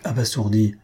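ordinary delivery

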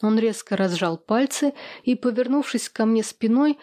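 usual speaking voice

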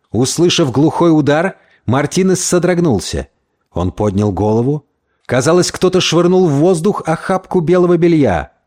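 usual speaking voice

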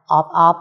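mock frog croak, short and loud; frog croak imitation. Husband answers wife's question, imitating a frog croak. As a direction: croak it out like a frog for your wife. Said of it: "con ếch ộp nó kêu thế nào".

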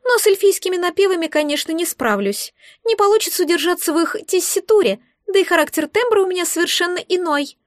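high, squeaky egg voice; musing creature. A narrator's reading. Egg weighs up its singing talent, musing. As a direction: explain in a high little voice. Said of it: "задумчиво ответило оно".

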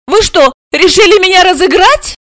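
high, huffy question; hurt, high-pitched indignation; offended. A narrator's reading. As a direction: ask as if insulted. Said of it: "обиженно осведомилась".

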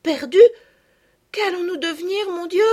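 high, loud panicked cry; terrified girl. A narrator's reading. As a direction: cry out in acted terror. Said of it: "répéta Marguerite avec terreur". (fillette affolée, terrifiée)

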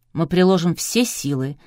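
ordinary delivery

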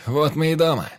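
loud, high proclamation; triumphant shout to the crew; rousing toast. A narrator's reading. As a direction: raise a glass and call out. Captain raises a toast.

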